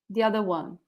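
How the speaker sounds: ordinary talk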